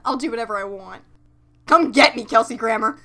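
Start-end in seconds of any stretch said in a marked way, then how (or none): 1.7-3.0 loud challenging taunt; mock taunt. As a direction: shout it like a playground dare. Taunting a TV star.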